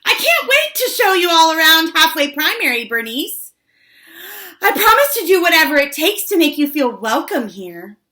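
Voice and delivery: very high, loud, rushed gushing; over-eager squeal of welcome, fast and loud; high and weird. A narrator's reading. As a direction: suck up to the principal in a high, weird voice. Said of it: "got all high and weird".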